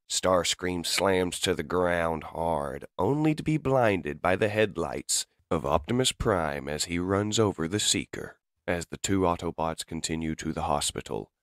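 ordinary delivery

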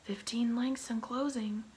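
high, loud call from above; lookout call. A narrator's reading. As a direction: shout the distance down to the deck. Lookout calls distance.

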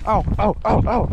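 ordinary delivery

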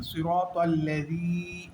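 slow "সিরাতাল্লাযী" with long ro and long final ii; one-alif elongation. Shown as a demonstration. Teacher modelling recitation, "সিরাতাল্লাযী" with its final ii drawn out. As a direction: stretch the last vowel of "সিরাতাল্লাযী". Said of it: "এক আলিফ টেনে বলবো".

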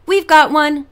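put-on boy shouting to the stranded pair; triumphant shout. A narrator's reading. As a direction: crow it like a victory. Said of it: "Winston let out a triumphant shout".

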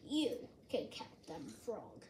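low, quiet, weary voice; drowsy, unenthusiastic reply; tired Toad. A narrator's reading. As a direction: decline softly and low, with no energy. Tired Toad declines Frog's plans.

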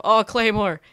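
high loud alarmed cry; sudden alarm at the mine; startled shout. Real, not acted. Spotting a mine.

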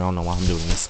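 usual speaking voice

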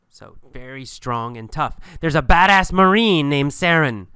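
ordinary talk, nothing marked

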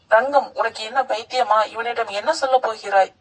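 plain reading voice

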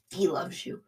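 normal talking voice

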